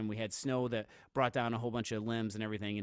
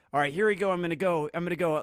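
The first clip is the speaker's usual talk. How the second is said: high, loud, hyped sprint call; pumped-up self-talk; 6 semitones higher, 6 dB louder, faster than usual. Retold or imitated. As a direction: fire yourself up to attack. Retelling psyching up for a sprint.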